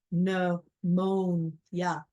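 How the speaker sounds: "pneumonia" very slow, syllable by syllable, MO stressed; stress on second syllable. Shown as a demonstration. Teacher sounding out a misleading spelling, slow stressed middle syllable in "pneumonia". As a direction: stretch "pneumonia" into three separate beats, weight on MO. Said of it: "again, second syllable".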